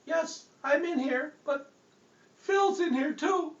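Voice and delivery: very high, slow tiny spirit voice; high eerie little voice. Acted. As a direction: answer like a small spirit inside. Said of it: "this little voice".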